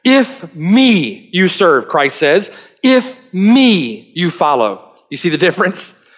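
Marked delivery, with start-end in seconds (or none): 0.0-1.9 slow "If me you serve" with "me" pressed; emphatic "me", said slowly; stress on me. Shown as a demonstration. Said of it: "the me in those statements is emphatic". 2.8-4.9 slow "If me you serve" with "me" pressed; emphatic "me", said slowly; stress on me. Shown as a demonstration. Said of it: "the me in those statements is emphatic".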